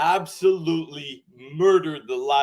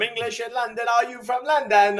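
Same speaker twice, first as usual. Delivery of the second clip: imitating a British accent, pitched up; a quick mock English accent; 8 semitones higher than usual. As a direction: mimic a Brit asking where you're from. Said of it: "You went from".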